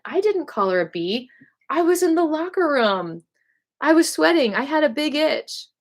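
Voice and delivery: high-pitched, insistent mock denial; lying excuse. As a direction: protest your innocence with a flimsy excuse. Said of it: "I lied".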